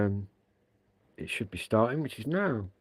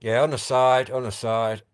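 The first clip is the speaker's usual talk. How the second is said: loud, raised call into the radio; 3 semitones higher, 8 dB louder than usual. Real, not acted. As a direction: call out across the distance. Calling back into the radio.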